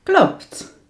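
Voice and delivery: klopt. jumps high and bright; agreeing answer. Shown as a demonstration. Teacher demoing a reply to students, high. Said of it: "how do you answer? You say".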